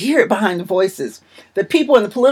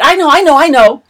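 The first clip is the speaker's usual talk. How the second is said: higher, louder, insistent "I know, I know, I know"; emphatic brush-off; 3 semitones higher, 5 dB louder than usual. Retold or imitated. Friend refuses to stop shopping, emphatic and insistent. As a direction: imitate a friend emphatically waving off a warning. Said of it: "she was emphatic".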